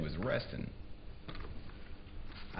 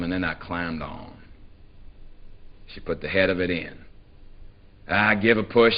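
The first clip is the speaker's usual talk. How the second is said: plain reading voice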